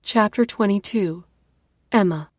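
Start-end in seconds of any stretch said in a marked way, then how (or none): none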